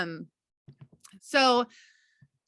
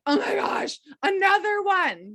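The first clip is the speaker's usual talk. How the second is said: higher, louder mock dismay, slowing on another one; exasperated groan; 8 semitones higher, 4 dB louder than usual. Leader mocks dreading another team member quitting, exasperated. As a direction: play the fed-up team leader. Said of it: "rather than being like".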